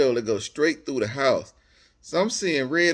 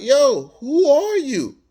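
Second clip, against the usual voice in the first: confused retort; 11 semitones higher, 7 dB louder, slower than usual. Retold or imitated. Confronted by an angry stranger.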